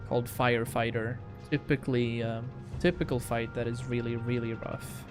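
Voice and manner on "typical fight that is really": ordinary talk, nothing marked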